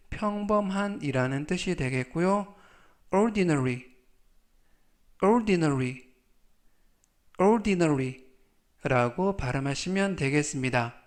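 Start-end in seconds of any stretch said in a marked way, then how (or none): 3.1-8.3 careful "ordinary" with a falling end; slow model reading. Shown as a demonstration. Teacher reading a word for students to repeat, slowly. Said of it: "천천히 세 번씩 읽어드리고요".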